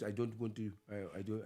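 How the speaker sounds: a hint of mock African English. Shown as a demonstration.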